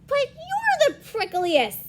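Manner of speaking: high, loud storybook objection; animated protest. Acted. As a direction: draw out a high-pitched protest. Rival characters protest in a story.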